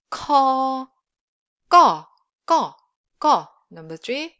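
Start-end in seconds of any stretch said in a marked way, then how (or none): 0.1-0.9 "ko" long, higher, strong puff; airy k then held vowel in "ko"; aspirated k. Shown as a demonstration. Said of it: "let's just read each sound". 1.7-3.5 "ko" short, loud, no puff; hard, punched Korean tense "ko". Shown as a demonstration. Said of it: "let's just read each sound".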